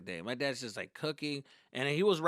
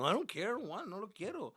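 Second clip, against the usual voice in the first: stubborn refusal; 8 semitones higher than usual. Retold or imitated. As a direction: refuse like a pouting son. Acted stubborn refusal to mother.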